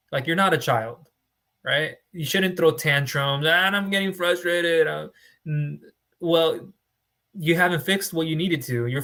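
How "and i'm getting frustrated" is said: slow, higher mock complaint of a sulking client; mock whiny client. Broker mimicking a client who throws tantrums, mock whine. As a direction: play the frustrated client, a little childish.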